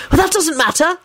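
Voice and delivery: high-pitched minister dropping a hint; hint pointedly in a high, fussy voice; meaningful. A narrator's reading. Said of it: "Fudge said meaningfully to Kingsley".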